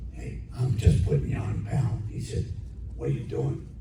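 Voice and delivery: very low, soft imitated producer voice; hushed low mock tough-guy reassurance; teasing tough guy. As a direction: drop deep and quiet, playing the joker. Acted producer admitting he was teasing.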